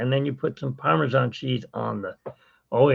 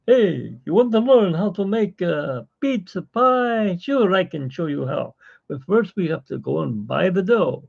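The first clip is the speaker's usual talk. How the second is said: higher, hammed-up Italian accent; Italian chef accent; 6 semitones higher than usual. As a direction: play a jolly Italian chef. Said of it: "I talk like this because I'm Italiano".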